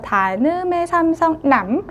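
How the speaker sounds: ordinary talk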